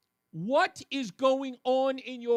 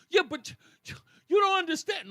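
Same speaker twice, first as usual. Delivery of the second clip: agitated protest; 9 semitones higher, 6 dB louder than usual. Retold or imitated.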